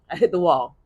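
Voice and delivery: ordinary talk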